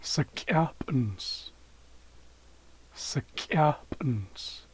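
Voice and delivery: Sekapens drawn out slowly, syllable by syllable; slow Haisla demo word. Shown as a demonstration. Teacher slowly modelling Sekapens for viewers. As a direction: spell Sekapens out slowly for learners. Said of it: "is Friday".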